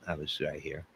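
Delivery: playing himself as suspect, answering softly. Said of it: "I'm gonna interview myself".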